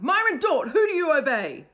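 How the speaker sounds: put-on boy demanding obedience from the children. A narrator's reading. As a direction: press the crowd for an answer. Will confronting Myron.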